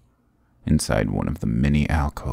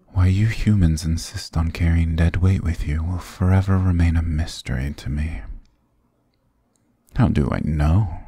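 usual speaking voice

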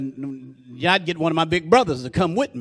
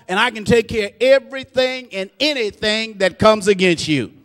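preaching; 6 semitones higher, 6 dB louder than usual. Acted. Preacher speaking as God to the congregation, loud and high. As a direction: declare God's power to the room. Preaching God's words loudly.

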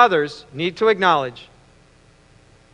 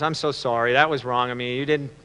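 low, soft, sincere apology; acted remorseful dad, hushed and low; apologetic father; 5 semitones lower, 3 dB quieter than usual. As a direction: gently make it up to the boy. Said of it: "apologize to his son".

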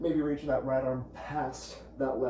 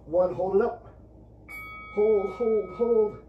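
high, loud calls to hold; loud coaching call; 6 semitones higher, 6 dB louder than usual. Real, not acted. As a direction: push the class to hold it.

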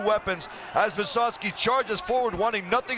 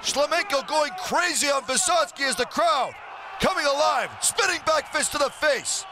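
excited play-by-play; 4 semitones higher than usual. Real, not acted. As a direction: call the flurry like it's happening now. Commentator calls knockout flurry.

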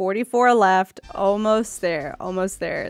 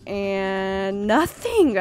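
drawn-out letdown; 2 semitones lower, much slower than usual. Real, not acted.

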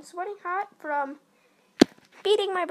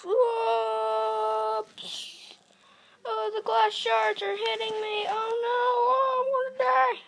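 mock panic; 6 semitones higher than usual. Mock panic at glass shards.

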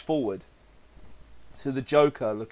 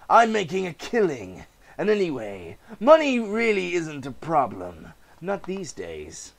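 higher, sneering salesman voice; haggling over a derelict amusement park, smug; smug sly dealmaker; 11 semitones higher, 6 dB louder than usual. Acted. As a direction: gloat over the bargain. Voices park owner and buyer.

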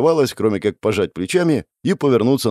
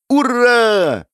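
put-on very high, loud girlish hooray; elated squeal of victory; jubilant cheer; 13 semitones higher, 5 dB louder than usual. A narrator's reading. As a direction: shriek it with joy, stretched out. Girl cheers and leaps to hug.

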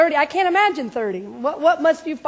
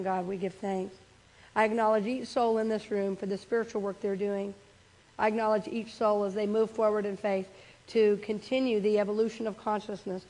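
softer prayer voice, chant the thanks; leading prayer; 3 semitones lower, 4 dB quieter than usual. Acted. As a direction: guide the room gently into prayer. Leads a closing prayer.

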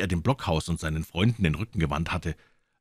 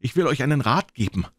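higher-pitched doctor hailing the cook; calling out; 9 semitones higher, 5 dB louder than usual. A narrator's reading. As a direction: call over with a word of advice. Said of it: "rief er".

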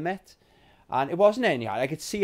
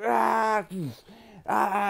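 high strained rage groans; snapping the game in bits; rage cries; 8 semitones higher than usual. Retold or imitated. Gamer snapping the disc, high groaning. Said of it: "I was just like".

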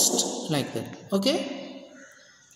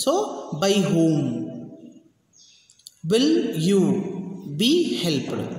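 slower, word by word for the class; slow repeat; 3 semitones lower, much slower than usual. Shown as a demonstration. Teacher repeats the question slowly for students. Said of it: "I'll, I'll repeat".

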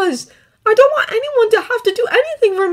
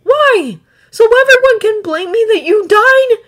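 high, loud challenge; upset protest; 14 semitones higher, 10 dB louder than usual. A narrator's reading. Boy to the dragon urging him to keep the power, protesting. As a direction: throw the question back in hurt anger.

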